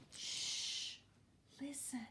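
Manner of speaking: hushed, very soft whisper. A narrator's reading. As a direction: hush them and whisper. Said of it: "Dot whispered".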